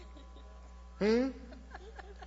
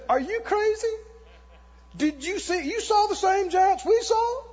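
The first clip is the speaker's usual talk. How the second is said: high, disbelieving cry; you can't be serious; the men protesting against Caleb in disbelief; incredulous outburst; 9 semitones higher than usual. Acted.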